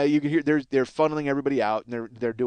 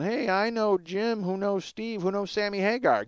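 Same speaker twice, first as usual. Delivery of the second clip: higher, drawn-out wheedling imitation; mock weaselly name-dropper; 5 semitones higher, 3 dB quieter, slower than usual. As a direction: imitate a pest angling to get in. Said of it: "every weasel in the fucking world".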